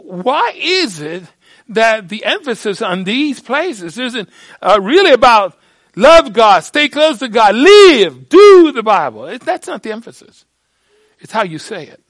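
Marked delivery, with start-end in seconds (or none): 0.0-10.4 louder, rising challenge; indignant preaching. Real, not acted. Preacher challenging seminaries, loud and rising. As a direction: demand it of them.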